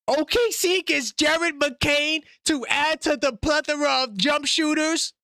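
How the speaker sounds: excited, spaced-out reveal of the trade; hyped trade read. Real, not acted. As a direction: lean on each pick like it's huge news. Reading out big trade details.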